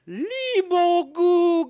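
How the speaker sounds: loud, drawn-out high shriek; monster yell. A narrator's reading. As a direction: scream a fish-creature war cry. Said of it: "you hear her yell".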